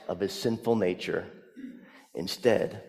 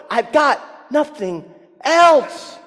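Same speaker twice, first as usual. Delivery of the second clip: higher, louder, slow anguished shout; nothing else, cried out slowly and loud; desperate cry; 14 semitones higher, 7 dB louder, slower than usual. Retold or imitated. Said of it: "he cries out".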